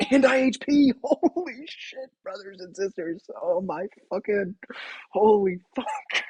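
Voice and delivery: high, laughing, breathless exclamations; giddy swearing. Real, not acted. Gamer giddy over the roll, laughing. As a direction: giggle and swear in joy. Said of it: "I'm giddy right now".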